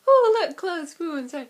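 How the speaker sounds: high, loud excited squeal; gushing high and bright over clothes; Lily gushing. Retold or imitated. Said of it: "she'll just be doing something like".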